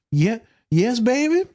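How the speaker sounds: high, rising mock-sweet answer; mock flirty. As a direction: answer coyly, pitched well up. Answering her "Hey" as a joke.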